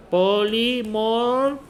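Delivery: stretched slowly, syllable by syllable; slow fill-in-the-answer cue; drawn-out prompt. Shown as a demonstration. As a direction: draw the word out for the class to join in. Prompts class to complete the answer.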